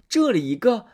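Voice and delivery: ordinary delivery, nothing marked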